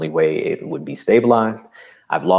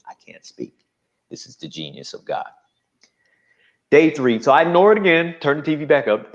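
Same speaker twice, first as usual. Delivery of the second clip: ordinary talk, nothing marked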